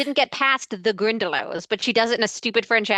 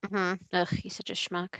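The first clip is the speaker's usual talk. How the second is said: usual speaking voice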